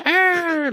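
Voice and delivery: high squawking bird call; bird cry. Retold or imitated. Climber mimicking vultures circling overhead, a high squawk.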